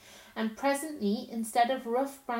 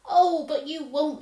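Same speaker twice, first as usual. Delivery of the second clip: pleading; 6 semitones higher, 8 dB louder than usual. Acted. Lucy pleading with Tumnus.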